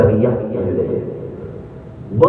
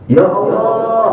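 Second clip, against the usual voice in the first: higher, slow, drawn-out appeal to God; third cry; 9 semitones higher, slower than usual. Acted. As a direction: stretch the call out like a plea. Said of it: "Teriakan yang ketiga".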